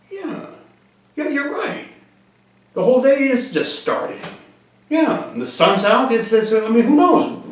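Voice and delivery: high-pitched, hopeful agreement; imitating a man cheered by a pep talk; dawning hope. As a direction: start small and high, growing surer. Retold man agrees, newly hopeful.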